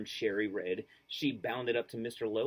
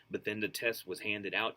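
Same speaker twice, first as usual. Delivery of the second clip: ordinary delivery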